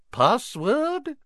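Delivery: a very high, prim woman's voice; fat lady portrait. Acted. The portrait questioning the prefect, high prim voice. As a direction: ask primly from the frame.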